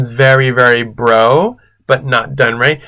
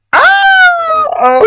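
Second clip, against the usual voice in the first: very high, loud squeal; sudden falsetto scream; high shriek; 4 dB louder than usual. Real, not acted. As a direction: let out a piercing scream. Reacts on finishing the reading.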